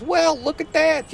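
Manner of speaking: high, slow, drawn-out marvelling; a king gawking at a room of gold; amazed king. Acted. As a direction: stretch it out in wide-eyed wonder.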